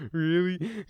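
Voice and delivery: put-on high, loud, sobbing small-kid voice; acted kid crying fit, words half understood; crying little kid. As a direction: blubber it out like a panicked little boy. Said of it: "best reenactment I could do of a little kid fucking crying".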